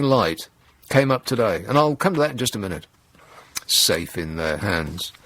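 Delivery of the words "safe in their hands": low, slow sarcastic aside. Real, not acted. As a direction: let the irony drip.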